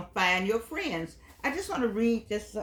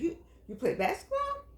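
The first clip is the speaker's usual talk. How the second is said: bright friendly question; 12 semitones higher than usual. Retold or imitated. Friendly opener to drive-through lady.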